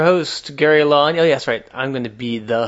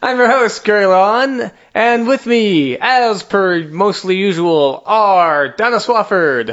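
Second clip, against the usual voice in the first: raised, drawn-out host introduction; show host announcement; 6 semitones higher, 6 dB louder, slower than usual. Acted. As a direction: announce the line-up, stretching out the names.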